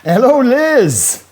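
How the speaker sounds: high, slow, loud hello; excited greeting. Real, not acted. Host greeting a caller on his radio show, delighted and loud.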